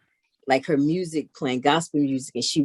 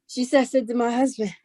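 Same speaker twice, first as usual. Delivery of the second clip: normal talking voice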